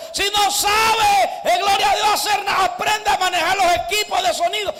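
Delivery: rally the whole church with a roaring call; preaching at full force. Acted. Preacher urging the congregation to serve God, full-force shouting.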